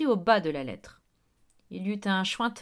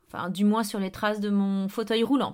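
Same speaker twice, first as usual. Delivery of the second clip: ordinary delivery, nothing marked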